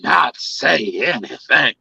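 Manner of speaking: mock her dramatic complaint; imitating a complaining woman. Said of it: "This is what she'd have said".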